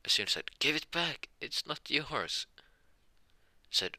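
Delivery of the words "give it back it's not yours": much higher, drawn-out plea; hammed-up child's protest, pitch jumped right up. Juno pleads with the drunk who grabbed the pendant, high-pitched protest.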